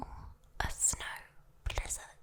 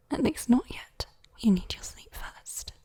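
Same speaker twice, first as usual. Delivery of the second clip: normal talking voice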